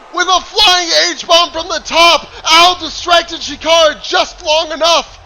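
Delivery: loud, high, still thrilled; excited recap. Real, not acted. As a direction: keep the big energy going. Recap right after the pin.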